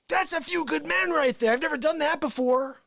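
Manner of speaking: ordinary talk, nothing marked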